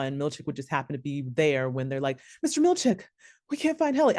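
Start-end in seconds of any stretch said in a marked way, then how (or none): 2.4-4.2 lightly voiced worried campers, pitched high; mock calling out. Team calling to Mister Milchick for help, high mock alarm. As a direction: call up high for the supervisor.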